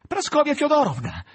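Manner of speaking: loud, high call of the name Praskovia Fyodorovna. A narrator's reading. Ivan calling the nurse in alarm, loud high shout. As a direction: shout Praskovia Fyodorovna across the room, nervous. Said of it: "Chiamò".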